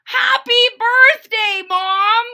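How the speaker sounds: put-on high loud happy kid voice, slow; Froggy's voice. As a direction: son proudly greets mum. Froggy greets mother.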